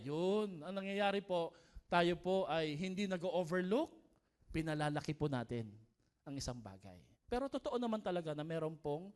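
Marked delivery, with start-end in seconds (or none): none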